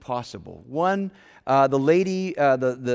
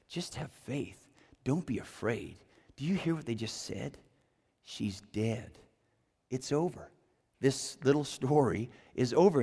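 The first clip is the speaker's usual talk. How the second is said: hushed reassurance; 3 semitones lower, 6 dB quieter than usual. Retold or imitated. Jesus soothes Jairus after the death report, hushed and tender. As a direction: lean in and calm a frightened father quietly.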